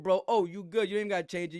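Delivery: ordinary talk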